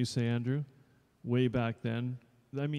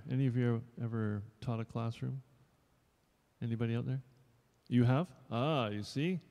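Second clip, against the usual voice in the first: normal talking voice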